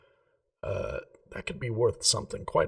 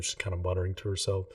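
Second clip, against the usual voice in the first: ordinary talk, nothing marked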